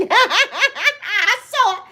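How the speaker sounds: slow, laughing I saw it; laughing tease. Real, not acted. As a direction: laugh while teasing her. Laughing teasing.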